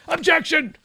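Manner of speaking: very high-pitched mock yell; shouted objection. Host mimicking triggered Democrats as the prayer finishes, mock shouting. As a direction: cry out in exaggerated protest. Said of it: "people started shouting out".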